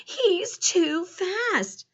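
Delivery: high, very slow, winded words; acted out-of-breath complaint; panting. A narrator's reading. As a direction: drag it out, worn out from the chase. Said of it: "Stanley said, panting".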